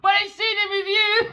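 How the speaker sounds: unhinged villain cackle, high and loud; evil voice. Pretend. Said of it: "That's my evil voice".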